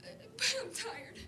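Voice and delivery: tearful, voice breaking high; crying. Real, not acted. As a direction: speak through real tears. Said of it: "I'm sorry that I'm crying".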